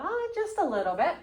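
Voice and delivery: high-pitched "just a bit"; implying a little. Acted. Teacher showing class how to imply a little, high hedging tone.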